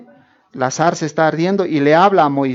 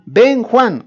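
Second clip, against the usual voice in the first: higher, lightly called out; calling out a name; 6 semitones higher, 4 dB louder than usual. Pretend. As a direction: pretend to call Juan over. Example of calling someone by name.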